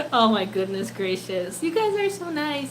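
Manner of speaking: ordinary talk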